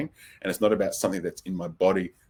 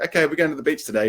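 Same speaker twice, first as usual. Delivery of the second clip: cheery announcement; 7 semitones higher, 6 dB louder, faster than usual. Retold or imitated. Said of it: "It's like".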